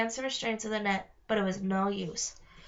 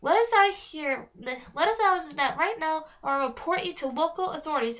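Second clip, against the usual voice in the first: angry demand; 5 semitones higher, 4 dB louder than usual. Acted. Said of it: "Alex said".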